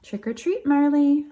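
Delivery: high, bright sing-song greeting; cheery title call. Acted. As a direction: call it out to the little listeners.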